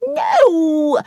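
high, loud indignant no; annoyed cry. A narrator's reading. Victoria denying she made it up, an annoyed cry. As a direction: snap it out, offended. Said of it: "cried Victoria, annoyed".

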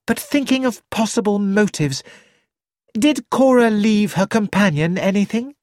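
lightly voiced energetic young woman, thinking aloud; a hint of a sharper young woman's voice; Susan. A narrator's reading. Susan reasons about the murderer.

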